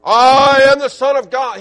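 loud, high proclamation to everybody; proclaiming. Retold or imitated. Preacher mocking a boastful claim, loud proclamation. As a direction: boom it out like a proud announcement. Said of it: "proclaiming to everybody".

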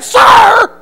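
loud, very high shouted order; a drill sergeant ordering a recruit to do push-ups; drill sergeant yelling. Retold or imitated. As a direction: scream the punishment.

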